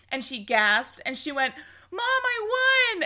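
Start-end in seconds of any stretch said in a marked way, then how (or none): 1.9-3.0 high, slow, thrilled shout to mom in another room; retelling a winner's yell to her mom; excited yell. Retold or imitated. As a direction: call out the big news, drawn out. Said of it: "she yelled it into another room".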